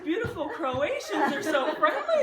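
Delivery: high, laughing fake cheer; mock optimism. Husband mocking his own optimism on lost roads. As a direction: force the bright side through laughter. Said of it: "I think to myself".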